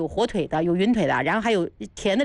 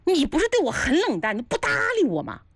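surprised; 8 semitones higher than usual. Retold or imitated. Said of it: "胡铁花都惊讶了".

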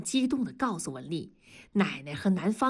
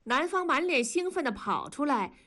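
ordinary delivery

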